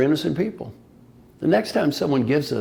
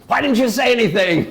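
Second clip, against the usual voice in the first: loud, high exasperated demand; husband blames his wife after the crash; accusing husband; 9 semitones higher, 7 dB louder than usual. Retold or imitated. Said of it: "I looked at my wife and goes".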